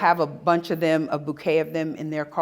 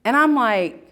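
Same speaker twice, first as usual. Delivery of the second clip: usual speaking voice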